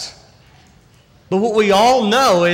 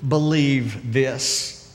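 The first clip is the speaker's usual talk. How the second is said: very slow question; 2 semitones lower, much slower than usual. Shown as a demonstration. Preacher presses the congregation, very slowly. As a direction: do you believe this stretched out word by word. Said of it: "So let me ask you".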